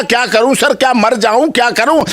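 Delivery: high-pitched retold cry of despair; mock distraught plea. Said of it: "अरे, रो पड़ा".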